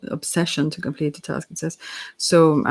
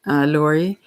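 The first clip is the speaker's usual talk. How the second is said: ordinary delivery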